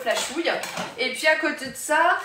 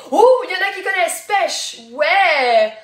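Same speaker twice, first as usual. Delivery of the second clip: excited; 5 semitones higher, 9 dB louder than usual. Real, not acted. A viewer guesses the colour right.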